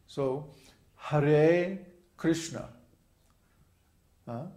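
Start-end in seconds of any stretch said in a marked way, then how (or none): 1.0-2.7 "Hare" with a long, loud "re", then short "Krishna"; long-short syllable lengths. Shown as a demonstration. Teacher demonstrating Sanskrit lengths, "Hare" drawn out, "Krishna" short. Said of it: "The Hare is long".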